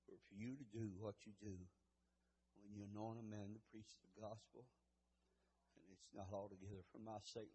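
low, very soft, quick murmured prayer; hushed pastoral prayer; humble prayer. Real, not acted. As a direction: pray quietly and humbly, voice sunk low. Opening prayer.

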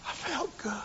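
breathy whispered relief; preacher recalling the relief. Real, not acted. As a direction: let the feeling out in a whisper. Emotional hushed moment.

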